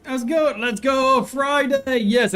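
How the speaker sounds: bright, high, hyped call-out; excited greeting. Real, not acted. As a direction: shout it out like a stream hype. Greeting chat, hyping Friday stream.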